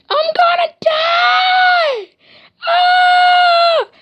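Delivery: put-on very high, slow, shrieking wail of a scared kid; panicked scream. Little kid sees his blood and screams in panic. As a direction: scream it like a terrified little boy sure he's dying. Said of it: "And I sound just like that".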